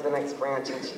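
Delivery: usual speaking voice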